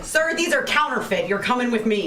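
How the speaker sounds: play-acted arresting guard. Guard arresting someone over counterfeit coins, mock-official. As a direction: make a pretend arrest.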